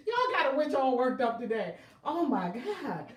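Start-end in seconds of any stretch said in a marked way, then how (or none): none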